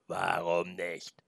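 slow, low growling question; low wolf voice. Acted. The wolf asks the boy why not, low and slow.

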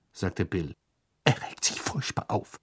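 whispered worry; hushed. A narrator's reading. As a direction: whisper it, glancing around. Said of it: "mit gedämpfter Stimme". (geflüstert, besorgt gedämpft)

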